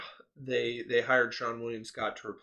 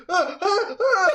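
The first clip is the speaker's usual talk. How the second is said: high, loud rising shrieks; ah ah ah, climbing higher and louder; panicked squeals; 13 dB louder, slower than usual. Retold or imitated. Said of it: "And I'm like".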